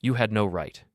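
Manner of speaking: usual speaking voice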